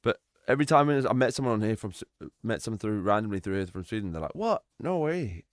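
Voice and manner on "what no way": put-on high, surprised Swedish accent. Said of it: "Like, in their Swedish accent".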